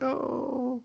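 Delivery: high, soft drawn-out coo; gushing aww. Retold or imitated. Teller reacting to the marriage proposal, gushing coo. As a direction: coo it, melting at the romance.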